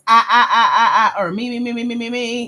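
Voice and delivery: loud, rapid-fire repetition in mockery; mimicking self-centred answers. Coach mimicking a self-centred grant answer, mocking chant. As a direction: hammer out each repeat like a chant. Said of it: "If your answer says".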